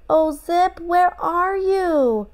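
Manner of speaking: put-on high, slow boy voice, forlorn; sad boy. A narrator's reading. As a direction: Ben sadly calling for Zip. Boy missing his dog.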